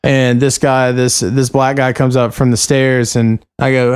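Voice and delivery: ordinary talk, nothing marked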